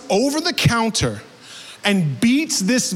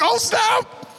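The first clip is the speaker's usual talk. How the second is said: high squeaky caught-out yelp; high startled exclamation; startled oh snap; 10 semitones higher than usual. Retold or imitated. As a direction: blurt it like you just got caught. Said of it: "I can imagine one was like".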